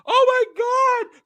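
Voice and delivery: very high, loud drawn-out shriek; hammed-up shock at a grisly discovery; overacted horrified scream; mock horrified cry. Everybody walks in on the body.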